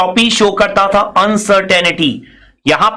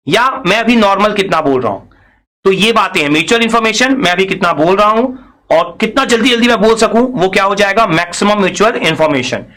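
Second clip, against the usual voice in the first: ordinary delivery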